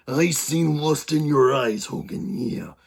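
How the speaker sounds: slow, put-on Macho Man promo voice trailing into a rasp; Randy Savage impression. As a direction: growl the line at Hogan like a jealous wrestler, badly on purpose. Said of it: "I know it's a terrible impersonation".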